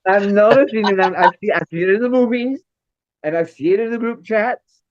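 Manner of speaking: high, loud, rising enthusiasm; high eager outpouring; excited. Real, not acted. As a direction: gush about family proudly. Excited building praise.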